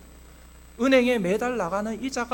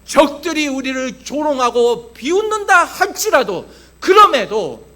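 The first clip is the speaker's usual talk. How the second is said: loud, emphatic sermon delivery; forceful preaching; 4 semitones higher, 8 dB louder than usual. Acted. Pastor to congregation on God defeating Goliath, forceful preaching. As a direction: proclaim it with full pulpit force.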